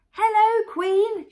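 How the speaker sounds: high, loud excited chorus; children shouting. A narrator's reading. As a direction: shout it like a group of kids. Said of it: "shouted the children".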